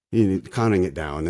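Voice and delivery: ordinary talk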